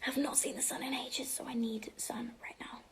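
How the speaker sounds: soft whispered longing. Real, not acted. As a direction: whisper it like a secret wish. Whispered plea for sun.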